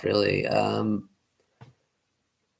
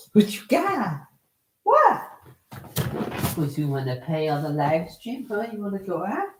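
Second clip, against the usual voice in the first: talking to a dog; 10 semitones higher than usual. Real, not acted. High baby talk to dog.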